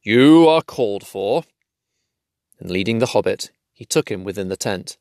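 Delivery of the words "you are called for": slow and louder, grave; gravely. A narrator's reading. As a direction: turn serious now. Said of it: "he said more gravely".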